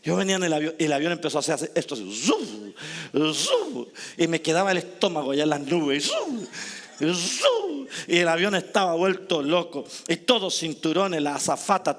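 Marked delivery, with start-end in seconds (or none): 2.1-7.9 imitated plane swoops, pitching up; plane noise. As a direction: make the plane's zoom sound.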